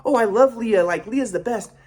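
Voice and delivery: mock parental gushing. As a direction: mimic parents gushing over their child. Mimics parents now praising the sister.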